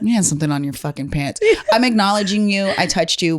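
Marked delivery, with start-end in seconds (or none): 0.0-1.4 coarse, laughing, buddy-style callout; blunt rough delivery. Shown as a demonstration. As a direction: say it like a rude friend, not a flirt. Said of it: "it's also the delivery".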